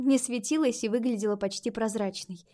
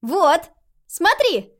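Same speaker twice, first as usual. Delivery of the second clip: put-on high, loud proud little girl; 7 semitones higher, 8 dB louder, much slower than usual. A narrator's reading. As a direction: show it off proudly. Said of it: "с гордостью продемонстрировала Айрис".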